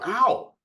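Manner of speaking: sudden high, loud mock "ow"; yelp of pain. Listener playing a pinched partner, mock yelp.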